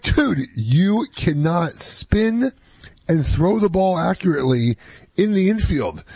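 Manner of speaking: high-pitched, drawn-out telling-off; emphatic disbelief. Retold or imitated. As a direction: spell it out slowly and incredulously, pausing between phrases. Dad firmly dismisses son's boast.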